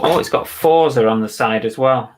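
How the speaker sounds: ordinary delivery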